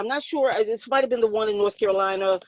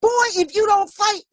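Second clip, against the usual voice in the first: yelling across the ring; 9 semitones higher than usual. Retold or imitated. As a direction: shout across the ring at him. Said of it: "I'm yelling across".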